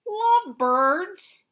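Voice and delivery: very high, slow puzzled question; baffled Elephant. Acted. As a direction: stretch the word out in disbelief.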